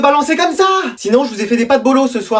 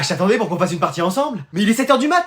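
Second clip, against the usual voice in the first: ordinary talk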